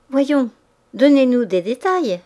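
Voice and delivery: insistent. A narrator's reading. Madame de Boves pressing Mouret for details, insistent. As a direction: coax him, won't take no. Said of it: "répétait madame de Boves avec insistance".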